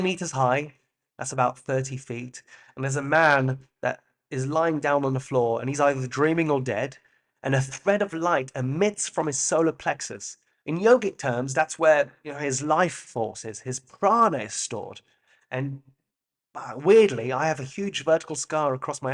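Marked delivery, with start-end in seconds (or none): none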